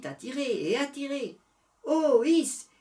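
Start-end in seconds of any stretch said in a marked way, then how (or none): none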